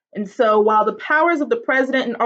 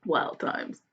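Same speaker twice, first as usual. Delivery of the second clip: "Wild times" breathed out unvoiced and much softer; whispered echo; 6 semitones lower, 11 dB quieter than usual. Real, not acted. Whispered echo of own remark.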